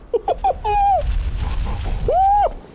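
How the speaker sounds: real high cackling laugh; high burst of laughter. Real, not acted. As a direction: crack up at the screen. Laughing at game mishap.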